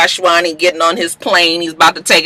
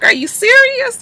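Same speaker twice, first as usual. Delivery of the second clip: high, incredulous mock voice; a light high Beam impression; voicing Beam. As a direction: pitch it way up in disbelief.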